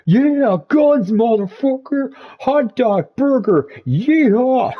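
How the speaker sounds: over-the-top yeehaw; mock Yankee talk. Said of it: "I can talk yank for you".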